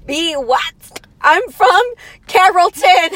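put-on high, loud Valley Girl voice. Friend hamming up being a Valley Girl, high and loud. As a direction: pitch it up and belt it like a Valley Girl. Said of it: "I sound like I'm a Valley Girl".

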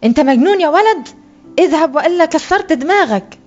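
put-on a vendor yelling at a pestering boy, angry; shouting vendor. A narrator's reading. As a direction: yell at the boy to get lost. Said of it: "صرخ في وجهي".

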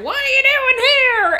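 high, loud dwarf shout; quoting a dwarf. Retold or imitated. Retelling the dwarves' first line, loud and high. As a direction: bark it like a rude hillbilly dwarf.

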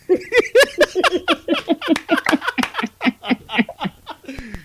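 long, high giggling fit that tails off; real laughter, unable to carry on; helpless laughter. Real, not acted. Woman asking for a moment mid-story, helpless laughing. Cracks up at the memory.

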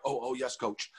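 put-on a caught-out kid mumbling back; meek kid reply. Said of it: "And they're like".

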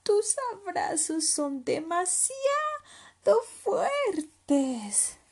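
high, loud, drawn-out complaint; slow strained protest in a high little voice; squeezed little leopard protesting; suffocated cub. Acted. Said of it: "dijo Leopardito sofocado".

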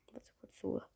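quick unvoiced whisper; hushed aside. Real, not acted. Aside while typing.

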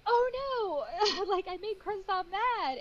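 high laughing oh-no; retold dismay. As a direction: squeak out the guilt. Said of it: "And then I was like".

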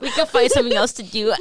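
words breaking into laughter; laughing. Real, not acted. Cracking up at wop joke.

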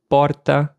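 porta with a light tongue-tap r before the t; "porta" with a single flicked r; tapped R. Shown as a demonstration. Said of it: "pronunciada de um jeito diferente".

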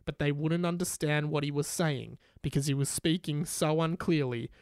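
usual speaking voice

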